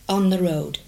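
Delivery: ordinary talk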